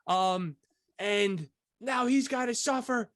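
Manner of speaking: high, slow, outraged mother; mock the angry TV mom, pitched high; slow high-voiced indignant mother; imitating the mother. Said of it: "this is what the mother says".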